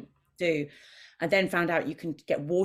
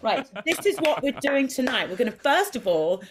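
lightly raised, loud bright announcing; brief the cats on tonight's plan; 7 semitones higher, 5 dB louder than usual. Retold or imitated. Telling her cats the evening plan, cheery. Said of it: "when I come in and I go".

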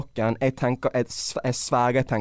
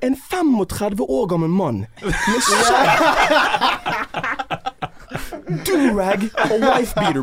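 high and loud, slow, laughing through it; laughing while telling the reveal; laughing disbelief; 10 semitones higher, 4 dB louder, slower than usual. Real, not acted. (leende, høyt og sakte)

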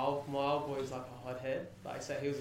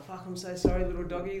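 higher, louder pleading apology, played for laughs; mock grovelling apology; 5 semitones higher, 8 dB louder than usual. Junkie apologising to the little doggy, mock grovelling. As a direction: imitate someone hastily making up to a dog.

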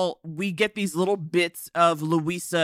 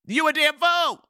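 higher and louder, a hammed-up scolding shout; mock yelled insult; 9 semitones higher, 4 dB louder than usual. Scolding the podcasting heroine, a loud hammed-up yell. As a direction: holler it at the screen like a fed-up auntie.

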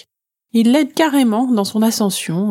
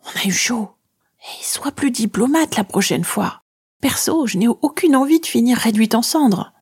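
hushed, higher, whispery murmur; murmuring shadow; 6 semitones higher than usual. A narrator's reading. Shadow warning the girl after a scare, whispery murmur. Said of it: "Elle me murmure".

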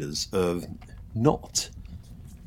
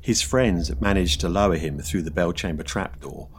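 ghost story narration; 2 semitones lower, 4 dB quieter than usual. A narrator's reading. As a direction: draw out the suspense.

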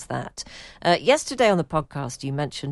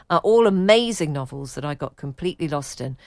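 ordinary delivery

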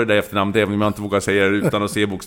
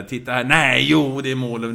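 lightly voiced excited match call, louder; commentator catchphrase; 4 semitones higher, 5 dB louder than usual. Commentator quoting his own famous call, lightly excited. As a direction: recall the famous call with a bit of energy. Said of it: "jag har den där".

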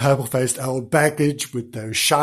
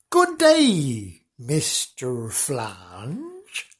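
madly grinning Wonks; much slower than usual. Acted. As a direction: stretch it out with a mad grin. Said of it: "grinning rather madly at the cow".

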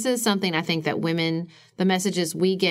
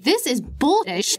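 fed up; 7 semitones higher than usual. Retold or imitated. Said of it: "be like".

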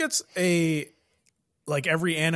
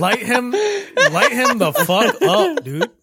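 high, loud laughing hype; 7 semitones higher, 4 dB louder, slower than usual. Real, not acted. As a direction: cheer him on while cracking up. Hyping the friend, laughing.

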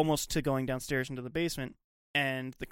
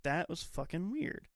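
quiet unease; 7 dB quieter, slower than usual. Retold or imitated. Characters lingering after the scare.

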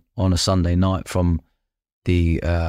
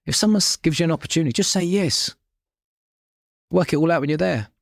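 emphatic advice; 8 semitones higher than usual. Real, not acted. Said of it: "that's my point".

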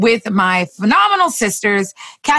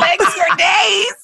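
high, slow, drawn-out cheer; excited shout; 9 semitones higher, slower than usual. Real, not acted. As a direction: hype it up, stretch the words. Hyping a friend's slogan.

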